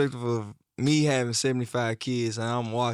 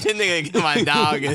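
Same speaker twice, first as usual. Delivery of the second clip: mocking imitation; 5 semitones higher, 6 dB louder than usual.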